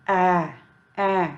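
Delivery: ए as the short vowel sound of A; phonics A sound. Shown as a demonstration. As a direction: demonstrate ए alone, as a phonics sound. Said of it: "A का sound क्या होता है". (ए, अक्षर A की ध्वनि)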